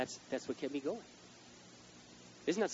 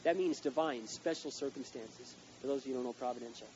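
loud, slow, higher preaching; full-force preaching; 6 semitones higher, 8 dB louder, slower than usual. Acted. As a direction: drive each word home.

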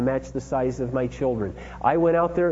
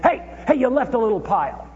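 high, hammed-up fault-finding shout; mock nitpicking yell; 9 semitones higher than usual. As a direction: pretend to be the picky dad. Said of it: "It wasn't like".

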